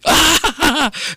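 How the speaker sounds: high-pitched acted gasp of surprise; startled cry. Grandfather hearing the whispered secret, startled cry. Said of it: "Kakeknya dengar itu kaget".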